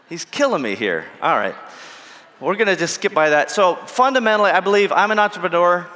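ordinary talk, nothing marked

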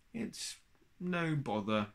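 lightly puffed bear voice; out of breath. A narrator's reading. Pooh to Piglet after digging him out, out of breath. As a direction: catch your breath between the words. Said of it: "puffed Pooh, trying to catch his breath".

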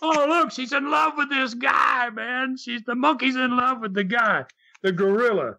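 high mock shout of a thrilled zoo crowd; high excited yelling, fading at the end. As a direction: cheer it like gawkers who just saw a romance. Crowd going crazy at zoo.

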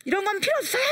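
put-on high, loud childish protest; child's refusal. Child refusing the king's helmet, high loud protest. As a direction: toss it away with a squeal.

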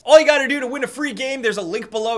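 big hyped host pitch; excited announcer. Pretend. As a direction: sell it loud like a host. Continues game show pitch.

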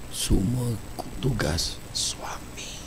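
whispered aside to the crowd; conspiratorial whisper to the audience. Acted. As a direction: drop to a breathy whisper for the punchline. Whispered punchline.

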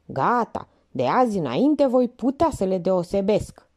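ordinary delivery, nothing marked

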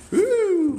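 high, joyful woo; excited whoop. Real, not acted. Host whooping at the beautiful loft, high excited woo. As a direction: whoop at the view.

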